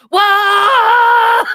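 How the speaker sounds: long, loud, high mock scream; imitated scream. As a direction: imitate a man screaming in overwhelmed shock.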